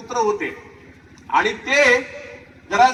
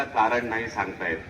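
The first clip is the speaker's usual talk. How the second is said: student's reply; 12 semitones lower, 7 dB quieter than usual. Retold or imitated. Student can't explain.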